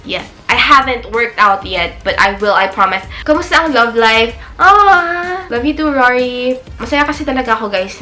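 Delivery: usual speaking voice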